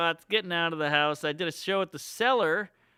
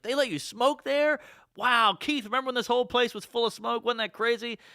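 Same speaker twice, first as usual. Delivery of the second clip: higher, excited mock Louis voice; imitating Louis; 8 semitones higher than usual. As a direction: play Louis amazed about smoking in the club. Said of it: "He's like".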